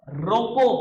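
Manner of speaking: रोको called out loud and high, then a stop; loud, lifted रोको, cut off for the comma; pause after रोको. Shown as a demonstration. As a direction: punch रोको with rising pitch and halt. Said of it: "पता कैसे बताते थे?".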